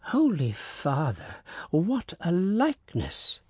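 slow, rising amazement; astonished servant. A narrator's reading. Servant staring at the recruit, slow and amazed. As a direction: marvel at the likeness, slowly.

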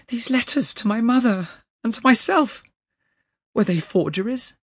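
very high, loud, shaken voice; distraught question. A narrator's reading. Young man asks about his father's letters, distraught. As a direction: demand it in bewilderment.

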